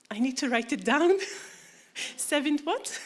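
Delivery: incredulous retold question with a laugh; amused bafflement. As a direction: ask it puzzled, rising high at the end. Retelling own baffled question, laughing.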